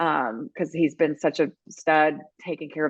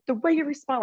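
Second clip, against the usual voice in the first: normal talking voice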